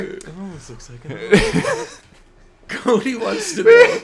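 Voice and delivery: slow, laughing hard; can barely get it out for laughing. Real, not acted. Both cracking up at the joke.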